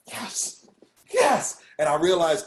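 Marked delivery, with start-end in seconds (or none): 0.1-0.7 whispered, voiceless yes; breathy mock yes. Poet in the front row reacting to the poem, hushed fervor. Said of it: "I hear her going". 1.1-1.6 high-pitched, thrilled yes; excited retold cry. Poet in the front row getting into it, excited cry. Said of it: "She's screaming".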